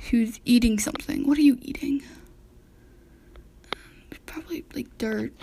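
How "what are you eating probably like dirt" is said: whispered. Real, not acted. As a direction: breathe the question. Whispering to eating dog.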